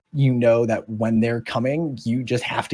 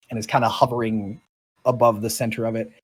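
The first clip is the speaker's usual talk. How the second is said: usual speaking voice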